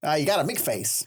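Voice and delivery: put-on laughing old-time gangster put-down; jokey 1920s Chicago tough guy; 1920s Chicago accent. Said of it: "sounds like a nineteen twenties put down".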